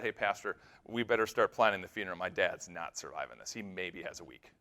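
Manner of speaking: low and hushed, sinking away; retold grave news, softly given; subdued bad news. Daughter expects father's death.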